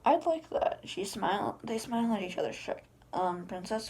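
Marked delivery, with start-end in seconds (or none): 0.0-0.8 bright eager reply. Acted. Princess answers the ogre's invite.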